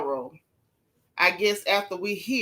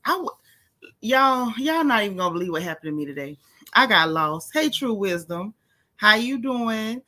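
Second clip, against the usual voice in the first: normal talking voice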